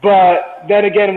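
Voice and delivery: ordinary talk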